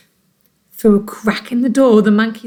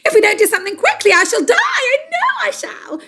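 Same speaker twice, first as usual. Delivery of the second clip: hysterical wail; 14 semitones higher than usual. Acted. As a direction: panic fast, then wail much higher. Missus Twit hysterical.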